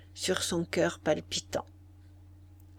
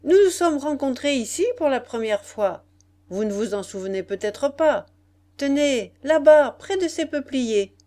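raised, higher voice of a general; calling out; 6 semitones higher, 7 dB louder than usual. A narrator's reading. General reminding the lord of their first meeting, calling out. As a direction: call across to him, loudest on the pointing words. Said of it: "s'écria le général de sa place".

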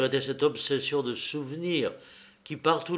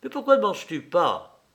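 brisk, raised-pitch demand; curt question; 7 semitones higher, 4 dB louder than usual. A narrator's reading. Mother questions the son who will not eat, curtly. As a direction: ask it curtly, starting high. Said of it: "demanda-t-elle d'un ton bref".

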